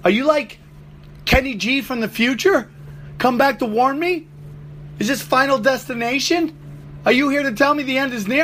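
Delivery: bewildered, piling on questions; incredulous questioning. Retold or imitated. Questioning the flute guy, high and baffled. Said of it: "I'm like".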